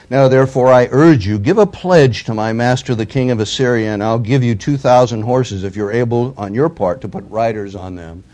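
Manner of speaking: loud, declaiming the envoy's taunt; forceful scripture reading. Acted. Assyrian envoy taunting Jerusalem, read out loud and forceful. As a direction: proclaim it like a herald's challenge.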